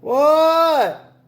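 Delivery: high, loud answering shout; high shouted reply; calling back. A narrator's reading. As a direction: yell back from bed. Froggy answers mother's call.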